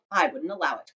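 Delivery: ordinary delivery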